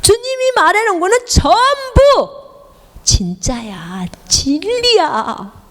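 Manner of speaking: high, pressing preacher's exhortation; fervent preaching. Acted. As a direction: drive the point home to the flock. (열정적인 설교)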